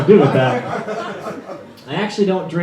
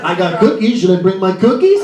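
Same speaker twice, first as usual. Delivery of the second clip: eager cookies; 4 semitones higher, 5 dB louder than usual. Retold or imitated. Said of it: "I, uh".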